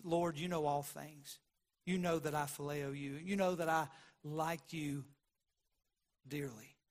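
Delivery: low, soft, hurt answer; quiet grieved confession; grieved reply. Retold or imitated. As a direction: let the hurt pull the voice down. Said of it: "the tone of his voice ... grieved Peter".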